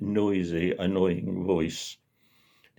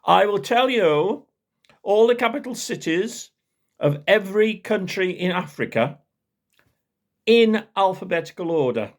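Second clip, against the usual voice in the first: posh bragging; 10 semitones higher than usual. Retold or imitated. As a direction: lord it over the room. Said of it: "off he went again".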